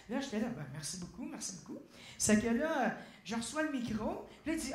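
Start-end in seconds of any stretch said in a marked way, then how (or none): 0.6-1.8 soft, low-key mumbled gratitude; meek thanks. Retold or imitated. Dancer answering the singer's praise, meek. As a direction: thank him softly and modestly.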